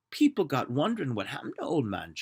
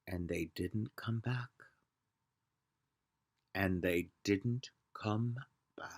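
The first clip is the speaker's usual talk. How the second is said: ominous whisper; 7 semitones lower, 7 dB quieter than usual. A narrator's reading. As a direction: let it hang in a whisper. Ominous repetition.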